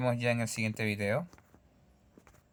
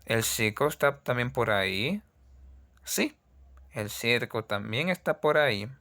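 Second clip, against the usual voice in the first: normal talking voice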